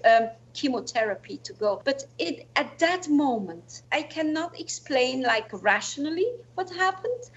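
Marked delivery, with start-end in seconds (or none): none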